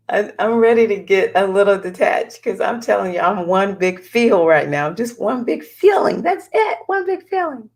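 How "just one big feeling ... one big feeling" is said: playfully over-emotional. Real, not acted. Vlogger admitting she's one big feel, playfully over-emotional. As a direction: voice climbing into a high squeal. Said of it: "very emotional".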